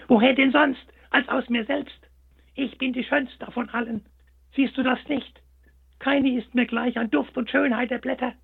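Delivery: high, haughty and snippy; a vain flower sniffing down at the hare; snippy rose. A narrator's reading. The proud rose snaps at the little hare, high and snippy. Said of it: "antwortete ganz schnippisch".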